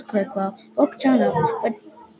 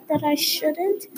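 higher, timid questioning squeak; frightened baby voice; 5 semitones higher, slower than usual. A narrator's reading. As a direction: answer like a scared little one, lightly acted. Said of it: "in a frightened slash crying baby voice".

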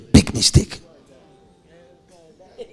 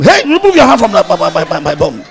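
a pastor mimicking a wife scolding her husband; mock scolding wife; 6 dB louder than usual. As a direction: scold him like a naughty boy. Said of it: "When she touched the bum".

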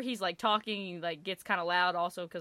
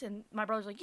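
normal talking voice

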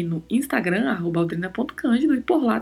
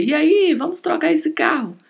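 eager pitch, higher and louder; higher, louder acted offer; friend's sales pitch; 8 semitones higher, 5 dB louder than usual. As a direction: pipe up like a car salesman friend nudging a buddy. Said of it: "ele não perde a oportunidade de te dizer".

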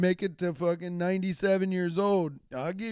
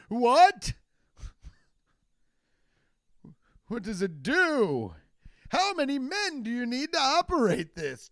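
amazed; 10 semitones higher than usual. Acted. Old man amazed by chainsaw.

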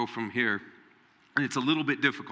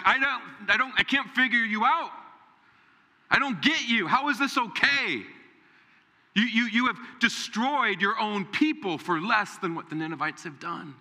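higher, exasperated protest to God; exasperated Jonah; 8 semitones higher than usual. Retold or imitated. Jonah asks God how this is okay, exasperated. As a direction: Jonah pleading in disbelief. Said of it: "he's like".

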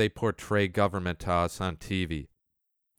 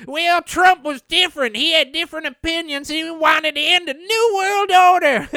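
much higher, loud whiny Trump-fan voice; mocking Trump fan; 6 dB louder than usual. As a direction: parody a whining believer. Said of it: "Some will say to me".

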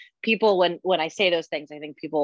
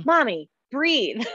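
imitating a calm little boy; 6 semitones higher, much slower than usual. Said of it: "he did it in the tone and the way that I have always done it".

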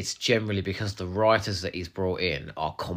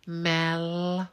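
high, drawn-out name, acted; hammy, held single-word cry; camp dramatic call; 9 semitones higher than usual. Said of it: "he grabs Mel's wrist and goes".

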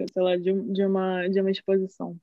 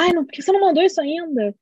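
fast, high-pitched exasperated mum; quick, higher mock scolding; imitated irritated mother; 6 semitones higher, 4 dB louder, much faster than usual. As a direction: act out mum losing patience. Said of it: "minha mãe começa a ficar irritada".

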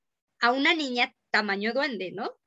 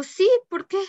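higher, louder nervous answer; startled reply; 8 semitones higher, 5 dB louder than usual. Retold or imitated. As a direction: answer too quickly, caught off guard. Answering mom while scared. (respuesta nerviosa y sobresaltada)

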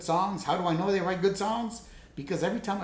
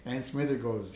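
ordinary delivery

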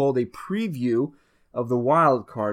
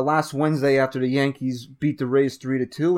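ordinary delivery

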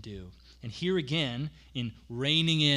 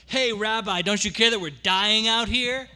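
shouted, much higher and louder, half sarcastic; urgent panicked plea; 10 semitones higher, 9 dB louder than usual. Retold or imitated. Disciples waking Jesus in the sinking boat, panicked shout. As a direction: yell it like you're sinking in a storm. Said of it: "I imagine it was more like".

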